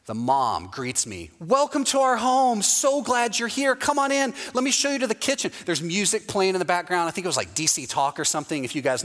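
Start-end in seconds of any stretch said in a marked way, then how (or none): 1.4-5.5 welcoming hostess. Retold or imitated. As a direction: play the beaming church mom at the door. Host mom greets him at door.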